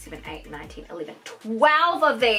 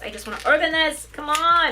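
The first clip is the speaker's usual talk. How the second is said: higher, hurried eagerness; impatient excitement; 5 semitones higher than usual. Real, not acted. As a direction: urge it on, itching to open it. Impatient to open the box.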